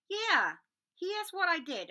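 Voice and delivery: loud, high burst of remembering; hearty Hagrid. Acted. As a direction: boom it out as he remembers. Hagrid voiced recalling.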